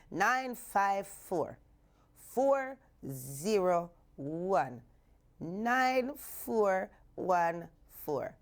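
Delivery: slow, spaced digits; number read out slowly for callers; slow dictation. Real, not acted. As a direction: read the digits slowly so they can dial. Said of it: "dictating the number".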